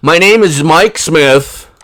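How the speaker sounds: hint of a mock undercover alias; mock fake name. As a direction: say the fake name like it's obvious. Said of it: "Boy, that, oh".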